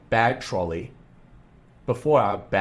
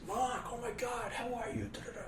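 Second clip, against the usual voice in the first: much higher, breathy falsetto gush; mock fangirl squeal greeting the pet industry star; gushing fan greeting; 14 dB quieter than usual. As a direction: mimic starstruck fans swooning over him. Convention crowd greeting the famous man.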